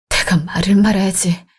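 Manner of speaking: a resigned whisper under the breath; whispered to himself. Acted. Husband, worn down by his wife's scolding, whispering to himself in resignation. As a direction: whisper it to yourself, giving up on the argument. Muttering after the quarrel.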